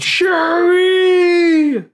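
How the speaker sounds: high, loud excited yell of the name; a real fan's scream at the screen. Retold or imitated. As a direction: shout it like the hero just arrived. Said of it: "first I yelled".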